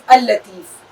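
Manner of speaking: اللطیف said al-latif with a held double l; doubled lam. Shown as a demonstration. Teacher showing students the double lam in اللطیف. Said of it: "لام کی آواز دو بار آ رہی ہے".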